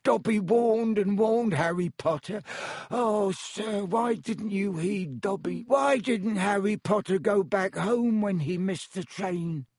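high, pleading creature voice; plaintive, reproachful little elf; distressed house-elf. A narrator's reading.

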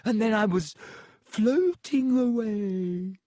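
put-on high, whiny ghost girl voice. A narrator's reading. Myrtle recalling death.

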